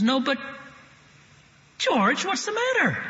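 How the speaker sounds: high, bewildered question; alarmed and confused at his haste; anxious mother. Retold or imitated. Mother asking George what is wrong, high and alarmed.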